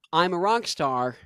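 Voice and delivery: ordinary talk, nothing marked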